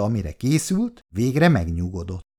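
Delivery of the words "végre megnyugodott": plain reading voice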